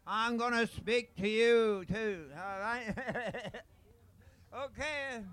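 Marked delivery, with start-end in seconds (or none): 0.0-2.2 louder and slower, projecting to the room; speaking up into the mic. Real, not acted. Auctioneer told to use the mic, booming and slow. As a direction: lean into the mic and boom it out. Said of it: "use the mic".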